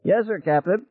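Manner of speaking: very high, squeaky helium voice; extremely high-pitched. A narrator's reading. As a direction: pipe it up high, as if full of helium. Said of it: "his voice was so high-pitched that it was almost unintelligible".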